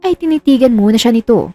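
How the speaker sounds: ordinary delivery, nothing marked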